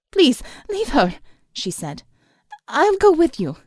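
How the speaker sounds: high, urgent begging; desperate plea. A narrator's reading. Alma begs the thug to spare the old woman. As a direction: beg him to stop, high and loud.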